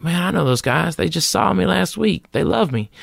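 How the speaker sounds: retold protest, pitched well up; indignant protest. Comic protesting to Jay about re-auditioning, indignant.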